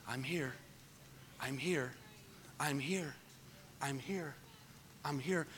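soft, hushed whisper of God; still small voice. Acted. Preacher voicing God's still small voice softly. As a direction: breathe it gently like God's quiet voice.

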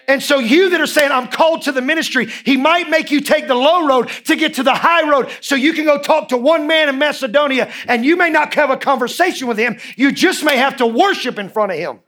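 high-pitched, driving sermon delivery; impassioned preaching. Real, not acted. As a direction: preach it with urgency. Preaches at full force.

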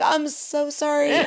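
high, slow, drawn-out sorry; mock mortified apology. Teller apologizes to the soaked stranger, mock mortified. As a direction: squeak out a sheepish apology.

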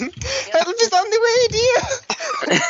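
mock screaming. Teasing about screaming on the roller coaster, mock screaming. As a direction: imitate a terrified rider wailing up high. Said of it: "Like, you're just screaming".

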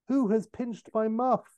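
high, mock-indignant demand; lightly acted imperious outrage; angry countess. Countess to servant about missing furs, mock angry. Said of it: "is angrily looking for her furs, shouting at her servant".

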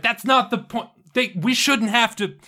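loud, high-pitched objection; indignant, cut off mid-argument; heated protest. Acted. Butcher protests, gets cut off.

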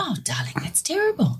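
put-on hushed, tender old lady; grandma's sympathetic whisper. Grandma sympathising with her frightened granddaughter, whispered. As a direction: breathe it out in sympathy.

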